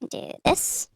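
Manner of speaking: whispered aside; breathy unvoiced murmur; whispering. Real, not acted.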